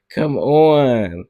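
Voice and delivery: normal talking voice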